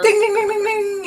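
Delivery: bell ding. Acted. GM imitating shop door bell.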